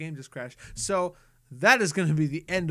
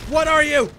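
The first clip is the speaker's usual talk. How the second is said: even louder, higher panicked yell; full shout; 11 semitones higher, 12 dB louder than usual. Real, not acted. Gamer panicking at the monster, full shouted question. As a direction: scream the question at it.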